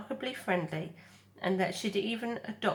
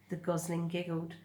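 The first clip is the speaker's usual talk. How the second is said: ordinary delivery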